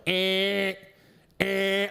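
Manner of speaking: a short honking "eh, eh" like a horn; imitated car horn. Buddy honking the truck horn for the lost hunter, honked "eh" sounds. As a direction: mimic a car horn being laid on. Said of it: "just starts laying on the horn".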